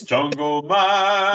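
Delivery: put-on voice, dragged out slow; Jungle Man voice. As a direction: ham up the promo voice change, stretching the words. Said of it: "this voice change or something".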